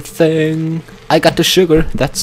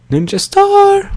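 excited high call; slower than usual. Real, not acted. Announcing a stunt.

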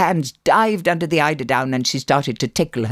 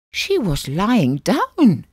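put-on higher, drawn-out child's protest; 7 semitones higher, 3 dB louder, much slower than usual. Child protests to Uncle Jack, high and slow. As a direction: insist on it slowly, pitched up.

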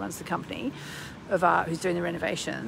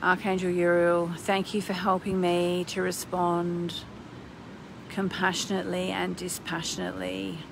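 prayer-like invocation; 2 semitones lower, 4 dB quieter than usual. Acted. Calls in an archangel.

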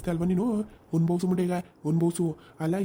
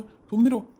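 sharp shout; 3 semitones higher, 5 dB louder, much slower than usual. Acted. Mother-in-law shouting the bride into silence, loud. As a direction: cut her off loudly.

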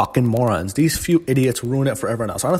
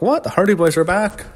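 higher, rising disbelief; retold shock, pitched up with a questioning rise; mock surprise; 7 semitones higher than usual. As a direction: act like you can't believe they're back. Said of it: "which was like".

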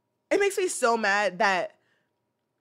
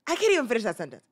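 ordinary talk

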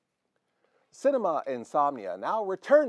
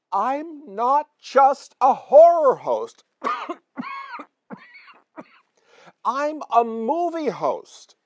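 indignant protest; 9 semitones higher, 3 dB louder than usual. Acted.

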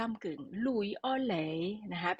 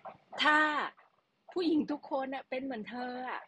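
snide commenter; 6 semitones higher than usual. Retold or imitated. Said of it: "ผู้ชายคนนี้อาจจะปากจัดนิดหนึ่งนะ".

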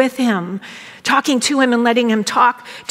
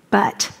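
"But," left hanging before a long silence; pause after BUT; 2 semitones lower than usual. Shown as a demonstration. Reading Jesus's rebuke to the church, pause after "But,". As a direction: say "But," alone, then hold the pause. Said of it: "there's just that pause".

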